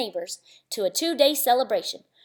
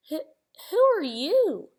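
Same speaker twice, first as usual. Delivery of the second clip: gasping; 6 semitones higher than usual. Acted. Cindy startled by the stranger, gasping. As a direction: catch your breath in surprise. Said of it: "gasped Cindy Ellen".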